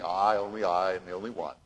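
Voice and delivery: low, soft mock lament; mock self-pitying prophet. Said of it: "You keep saying".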